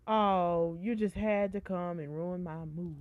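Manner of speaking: loud, drawn-out complaint; exasperated outburst. Real, not acted. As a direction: groan it out loudly at whoever interrupted. Scolds an interruption, mock drama.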